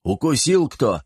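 grumbling follow-up question; displeased. A narrator's reading. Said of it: "недовольно спросил Шер".

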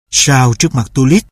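plain reading voice

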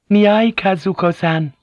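high-pitched call by name. A narrator's reading. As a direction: call to someone further off. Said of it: "Mariko called out".

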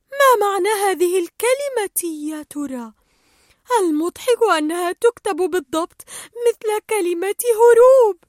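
high, loud, puzzled musing; wondering aloud. Acted. Dory puzzles over the word, thinking aloud loudly. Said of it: "وسألت نفسها بصوت عال".

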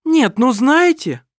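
high, loud, slow outraged cry; loud and high, drawn-out protest; indignant exclamation. A narrator's reading. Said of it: "воскликнула Лисин".

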